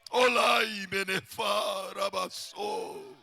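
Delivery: fervent glossolalia; speaking in tongues. Real, not acted. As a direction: cry out in ecstatic prayer language, then let it fade. Preacher breaks into tongues.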